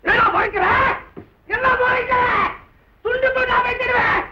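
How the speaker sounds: high, furious yelling; threatening shout. Acted. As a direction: menace him at the top of the pitch. Threatens the glaring rival. (மிரட்டும் கத்தல்)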